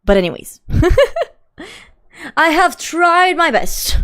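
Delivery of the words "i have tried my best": attempted British accent. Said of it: "Can you do a British accent?".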